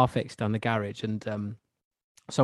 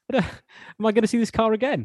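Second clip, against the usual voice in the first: mock nervousness; 9 semitones higher than usual. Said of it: "I felt nervous handing over my keys".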